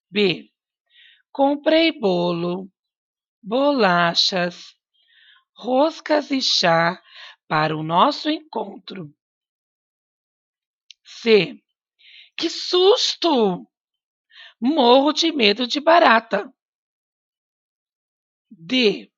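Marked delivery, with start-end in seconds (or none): 1.3-8.3 slow list with a pause after each item. Shown as a demonstration. Teacher reads a shopping list to the class, pausing at each comma. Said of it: "acertar os sinais de pontuação pela minha entonação". 12.4-16.5 high, slow gasp of fright; startled exclamation. Shown as a demonstration. Teacher acts out a fright so students hear the exclamation mark. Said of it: "pela minha entonação".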